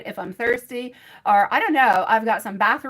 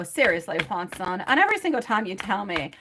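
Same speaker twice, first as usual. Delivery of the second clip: ordinary talk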